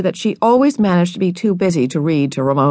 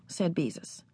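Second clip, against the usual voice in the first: plain reading voice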